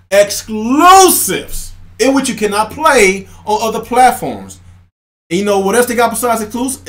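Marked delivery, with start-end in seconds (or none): 0.1-4.6 each word laid out slow for fans to repeat; slow emphatic spelling out. Shown as a demonstration. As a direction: say it slowly, like a teacher drilling a class. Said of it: "Xbox fanboys, repeat after me".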